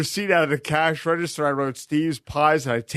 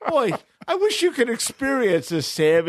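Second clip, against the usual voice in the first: a much higher, teasing voice that rubs it in; mock pity; 8 semitones higher than usual. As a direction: gloat at the cheap neighbour. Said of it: "And it's like".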